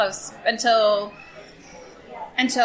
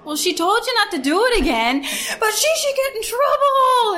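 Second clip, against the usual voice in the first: high, teary whine rising higher as it goes; whimpering kid complaint; 13 semitones higher, 4 dB louder than usual. Retold or imitated. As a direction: imitate a boy half-crying to his mom. Cousin complaining to mom.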